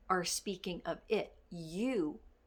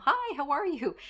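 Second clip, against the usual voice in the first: higher, louder chirpy hello; cheery employee greeting; 6 semitones higher, 6 dB louder than usual. Retold or imitated. An employee greets her boss brightly, higher and louder.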